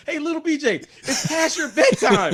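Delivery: sing-song teasing from the stands; heckler taunt. Retold or imitated. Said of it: "He said".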